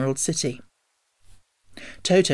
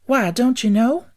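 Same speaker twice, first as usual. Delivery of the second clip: high, slow amazed question; surprised; 9 semitones higher, 5 dB louder, slower than usual. A narrator's reading. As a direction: ask it truly surprised. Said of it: "she returned in surprise".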